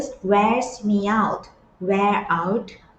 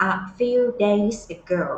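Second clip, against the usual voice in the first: very slow and clear; slow phrase model; much slower than usual. Shown as a demonstration. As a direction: stretch the phrase for learners. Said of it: "teacher models the phrase".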